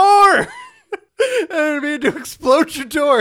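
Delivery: high, loud, drawn-out protest; hammed-up pleading through laughter; mock wail. Visitor apologising for exploded door, mock-wailing.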